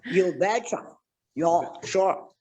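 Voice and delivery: playful Minnesotan twang on long O's; hammed-up Minnesota locals' talk; mock Minnesota accent. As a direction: put on a folksy Minnesota accent. Playing at local Minnesotan catchphrases.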